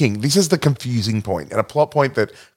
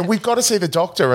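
higher-pitched retold pleading; mock urgency; 7 semitones higher than usual. Patients rush in to receptionist, mock urgency. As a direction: act out a pressing demand.

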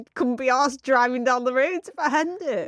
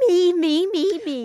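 sing-song mock narcissist; 6 semitones higher, 4 dB louder, slower than usual. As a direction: chant me, me, me mockingly. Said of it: "Me, a day about me".

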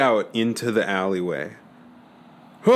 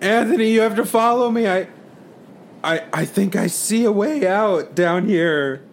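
calling out; 8 semitones higher than usual. Acted. Surfacing, calling to friend.

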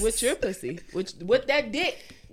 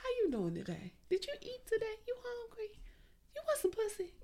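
high, soft come-on; 13 semitones higher, 10 dB quieter than usual. Acted. Offering herself to a lover, high soft sultry voice. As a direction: coo it up high and softly. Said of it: "sounds like [name]".

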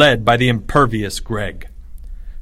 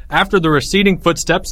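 usual speaking voice

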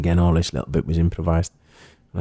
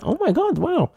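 high mock gasp of amazement; daughter amazed. Daughter amazed her dad is in the video, high mock voice. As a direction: squeal it like a starstruck kid.

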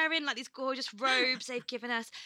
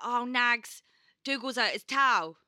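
louder Cockney mum impression, L dropped; mum's Cockney; 3 semitones higher, 5 dB louder than usual. Mum rings about the injured dog, imitated Cockney. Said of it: "she's a full-on Cockney".

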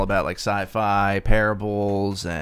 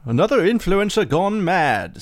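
lightly voiced British husband, higher; British accent; 8 semitones higher, 3 dB louder than usual. John reading the news to his wife, in a light British accent. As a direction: read the paper in a put-on British voice. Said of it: "I'll be John".

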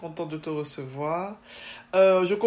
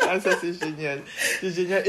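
laughing delight; 4 dB louder than usual. Real, not acted. Host delighted by guest's answer.

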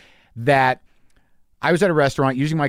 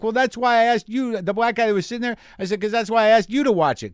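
acted yelling; 9 semitones higher than usual. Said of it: "I'm yelling some more".

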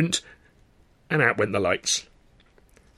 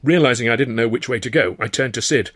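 plain reading voice

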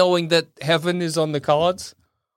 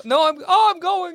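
overacted death scene; 11 semitones higher than usual. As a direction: ham up fading away. Ghost fading to heaven.

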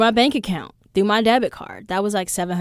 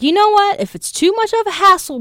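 much higher, louder retold comeback; huffy retort; 13 semitones higher, 9 dB louder than usual. Fed-up traveler telling clerk she'll take it, huffy retort. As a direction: throw the offer back with loud attitude. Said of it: "So I was like".

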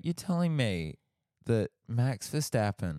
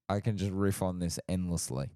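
usual speaking voice

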